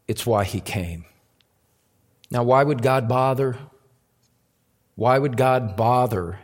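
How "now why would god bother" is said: ordinary talk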